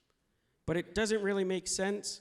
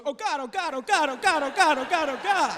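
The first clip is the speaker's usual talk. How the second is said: rising, louder, pitched-up panic prayer; desperate pleading; 6 semitones higher, 7 dB louder than usual. Retold or imitated. Clenching on the toilet, begging God in rising panic.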